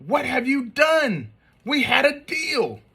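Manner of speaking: high, outraged cry of dismay; exasperated mom. Acted. Mom finding the pile of toys, high exasperated wail. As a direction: wail at the mess like a betrayed parent.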